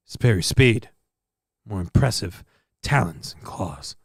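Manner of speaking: low, measured narrator with long pauses; mock nature documentary. Narrator explaining the leopard's failings, in low documentary deadpan. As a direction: narrate it gravely, letting the phrases sink away.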